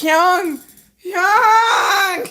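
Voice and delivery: put-on high, loud drunken holler breaking into laughter; mock drunken yell. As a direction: call out like a tipsy friend, hammed up. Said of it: "J K drunk is hilarious".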